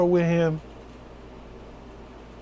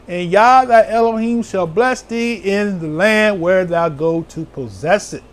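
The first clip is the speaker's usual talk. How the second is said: raised, slow, solemn scripture reading; 6 semitones higher, 3 dB louder than usual. Acted. Preacher reading Deuteronomy to the flock, raised and slow. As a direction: read it out like the pulpit Bible.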